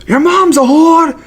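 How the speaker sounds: very high-pitched trash-talker impression; Bisping. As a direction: hurl it as mock taunts. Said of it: "Bisping being like".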